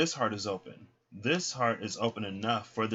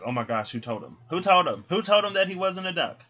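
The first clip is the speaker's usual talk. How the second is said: louder, high panicked who told him; scandalised outcry; 9 semitones higher, 8 dB louder than usual. Retold or imitated. The ducks, panicking that he learned the truth. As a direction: cry out in scandalised alarm.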